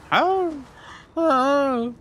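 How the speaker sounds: high incredulous howl; can't believe it, howl; surprised. Real, not acted. Surprised at howling.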